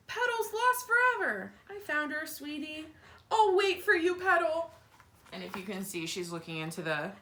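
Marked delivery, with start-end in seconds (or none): 0.1-4.7 put-on higher little girl voice; child voice. Wimberly fussing over her doll Petal, higher child voice. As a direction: play the kid talking to her doll.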